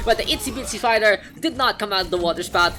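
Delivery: loud, high, fast esports casting; hyped play-by-play. Acted. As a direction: call the fight like a caster on the edge of his seat.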